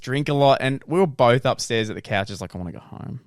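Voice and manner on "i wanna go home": low, much softer mumbled whine; drunk whine. Retold or imitated. Friend on the couch upstairs, mumbling softly to go home. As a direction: mumble it like a tired drunk wanting out.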